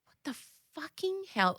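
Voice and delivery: a soft mimic of the toddler's 'What the fucking hell?'; imitating toddler's swear. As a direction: copy the child's tune on 'fucking', softly. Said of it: "the way he says it".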